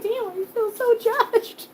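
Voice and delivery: laughing through the words, slow then quick; giggling while talking to the cat. Real, not acted. Owner laughing at her judgmental cat.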